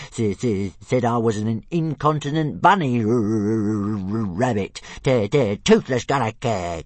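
loud stuttering dragon rant; indignant dragon. Acted. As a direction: splutter with outrage. Dragon rants about insult.

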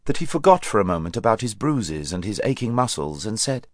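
ordinary delivery, nothing marked

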